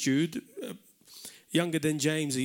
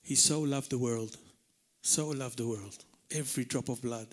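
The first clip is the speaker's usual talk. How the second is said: tender; 7 semitones lower, 6 dB quieter than usual. Real, not acted. Preacher softens on God's love.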